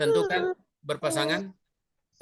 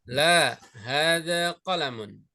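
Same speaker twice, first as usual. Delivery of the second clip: "La, hadza qalamun" slow, falling at the end; statement intonation; slower than usual. Shown as a demonstration. Teacher models a no answer for students, declarative "La, hadza qalamun". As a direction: answer "La, hadza qalamun" unhurried, settling downward. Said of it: "dengan pernyataan itu berbeda".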